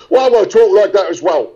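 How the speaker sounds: hamming up a Cockney lad like his co-star; Cockney accent. Retold or imitated. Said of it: "since he talks like this".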